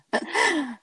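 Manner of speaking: a quick real laugh; bright little laugh; short laugh. Real, not acted. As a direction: laugh it off, amused. Laughs after the story.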